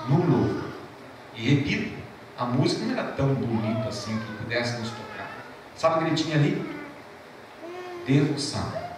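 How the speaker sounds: usual speaking voice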